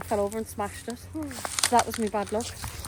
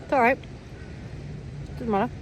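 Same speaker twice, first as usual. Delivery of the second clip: sarcastic; faster than usual. Retold or imitated. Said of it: "I just think".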